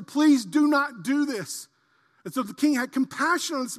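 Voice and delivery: loud, high storytelling sermon; full-force preaching. Acted. Pastor retelling the king and debtor, loud preaching. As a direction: tell the parable with fire.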